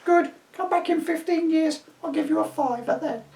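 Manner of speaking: put-on very high cheerful old woman; old lady voice. A narrator's reading. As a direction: chirp it like a sweet old granny.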